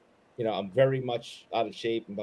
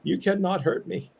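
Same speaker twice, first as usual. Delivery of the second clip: higher, louder hammed-up defiance; mock heroic; 9 semitones higher, 6 dB louder than usual. Teasing his cohost by echoing the boast, hammed up. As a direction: throw the line back in mock bravado. Cohost mocks the bold line.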